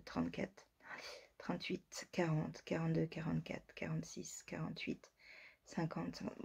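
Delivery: soft murmured counting; counting under breath. Real, not acted. Counting the patterns in the book under her breath. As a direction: whisper the numbers quickly. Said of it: "Je compte".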